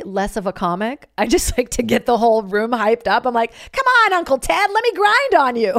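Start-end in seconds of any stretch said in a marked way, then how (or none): 3.7-5.7 high, loud party-hype voice. Retold or imitated. Comic playing DJ hyping up Uncle Ted, loud high party voice. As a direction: pump up the room like a bar mitzvah DJ. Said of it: "I'm more of a bar mitzvah D J".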